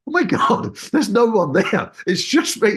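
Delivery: suddenly notice you're alone up there; shocked realization. Retold or imitated.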